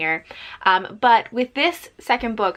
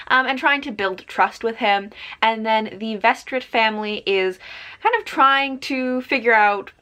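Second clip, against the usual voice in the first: normal talking voice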